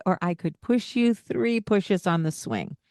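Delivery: normal talking voice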